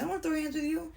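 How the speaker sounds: pitched well up, backing down. Retold or imitated. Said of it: "she's like".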